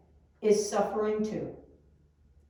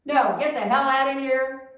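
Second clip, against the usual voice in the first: louder, higher acted rebuff; mock angry refusal; 4 semitones higher, 6 dB louder than usual. Answering someone's plea for help, a mock angry refusal. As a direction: play a brief, mildly angry brush-off.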